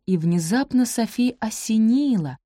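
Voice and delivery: ordinary delivery, nothing marked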